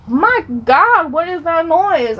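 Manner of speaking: high, alarmed mock cry; startled far-off people. Joking that people in China heard the crash, mock alarm. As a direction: play someone hearing a huge crash. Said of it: "People in China were like".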